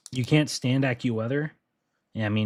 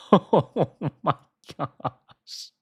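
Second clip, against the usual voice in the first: laughing; 5 semitones higher, much slower than usual. Real, not acted. Laughing at the crazy model run.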